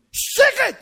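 high, loud demand for silence; frantic husband's sharp shout; shouted hush. Acted. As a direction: yell to shut someone up. Husband silences his wife.

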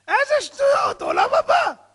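high, loud mocking outburst; pained heckling shout. Audience member rejecting the world to come, pained shout. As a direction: yell it out like a stung heckler. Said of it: "הוא צועק בכאב".

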